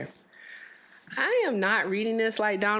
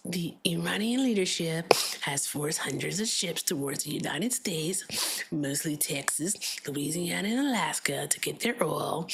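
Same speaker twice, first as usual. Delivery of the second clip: Trump impression. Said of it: "I'll do the Trump impersonation".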